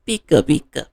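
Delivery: high, squeaky little creature cry; Pikachu. Acted. Pikachu answers Ash's praise, high creature voice. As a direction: chirp it high, like a small creature. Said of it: "in his Pikachu voice".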